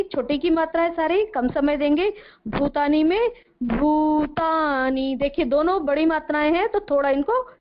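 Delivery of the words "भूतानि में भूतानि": भूतानि with भू and ता held long, slow; long vowels. Shown as a demonstration. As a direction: draw out भू in भूतानि. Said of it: "भू देर तक बोलेंगे". (भूतानि में भू लंबा खींचकर)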